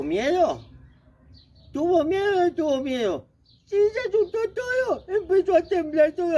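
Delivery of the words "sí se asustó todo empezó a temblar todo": high, trembling scared squeak; mock fright. Narrator acts grandpa frog trembling, high and scared. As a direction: play the frightened frozen grandpa.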